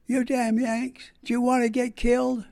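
retold holler. A lady hollers up at soldiers on a haystack, mock yell. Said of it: "this lady was hollering".

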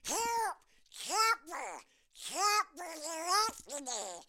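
high, squawky cartoon duck crying for help; little duck in a panic; Yankee Doodle. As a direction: squawk it like a frightened cartoon duckling. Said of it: "you'll remember it this way".

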